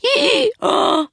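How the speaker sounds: sharp high gasp of shock. A narrator's reading. Shock at donkey's words.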